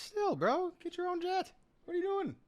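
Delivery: very high-pitched incredulous ribbing; mock scolding. Podcaster ribbing the bank VP, high mock-outraged scolding. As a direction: scold him in a squeaky, exasperated pitch.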